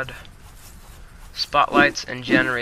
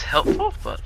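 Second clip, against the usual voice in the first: sudden cracking voice; 2 semitones higher than usual. Real, not acted. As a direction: let the voice crack mid-word. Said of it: "craziest voice crack I've had".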